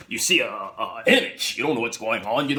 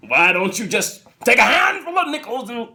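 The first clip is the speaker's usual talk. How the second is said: high, loud indignant retort; indignant shout; 9 semitones higher, 6 dB louder than usual. Retold or imitated. Husband shouts back at his wife's excuse. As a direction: fling it back in outrage.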